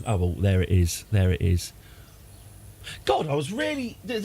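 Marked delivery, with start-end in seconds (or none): 0.1-1.7 low, flat, unimpressed mock reaction; underwhelmed. Imitating himself finding the obelisk, underwhelmed. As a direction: shrug it off like it's nothing special. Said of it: "look at it and go".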